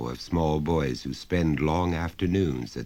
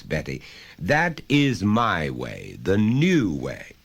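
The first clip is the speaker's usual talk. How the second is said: lecturing father; 6 semitones higher, 3 dB louder than usual. Acted. Father lecturing son and guest on learning, pompous. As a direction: preach your method proudly.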